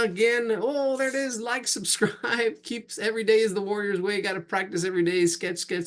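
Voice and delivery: cheerful stream host saying goodbye to viewers; upbeat sign-off. Acted. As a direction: wrap up the stream like a host signing off.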